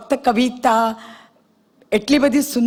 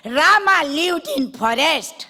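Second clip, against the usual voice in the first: put-on slow, higher sing-song child reading English; child reading aloud; 6 semitones higher, much slower than usual. Boy reads his Ramayana lesson in English, slow child's sing-song. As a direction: spell it out like a small schoolboy.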